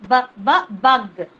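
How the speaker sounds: loud repeated bu then bug; phonics sound repeat. Shown as a demonstration. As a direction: drill the b sound. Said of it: "phonics sound demo".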